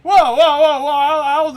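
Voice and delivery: loud, high stunned whoa; real shock at the voice. Real, not acted. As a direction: blurt it out in disbelief. Shocked by the singer's voice.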